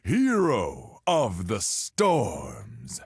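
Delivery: slow, high, long-drawn heroic cry; grand boastful proclamation. Acted. Sailor hailing himself king of the seas, grandiose proclamation. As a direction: stretch out the name like a sea king's fanfare.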